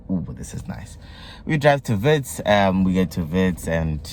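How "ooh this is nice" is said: whisper. Retold or imitated. Quoting reaction at graduation.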